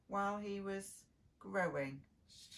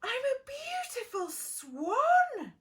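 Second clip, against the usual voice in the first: much higher, louder, slow breathless wonder; gasping wonder; 14 semitones higher, 9 dB louder than usual. Acted. Duckling seeing his reflection, gasping in wonder. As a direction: gasp it out in amazed delight. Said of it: "He gasped".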